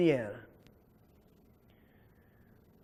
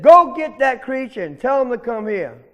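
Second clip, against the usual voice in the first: loud, higher bossy order; impatient command; 5 semitones higher, 6 dB louder than usual. Acted. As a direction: send the rabbit off with a bully's order. Alligator repeats his order to rabbit.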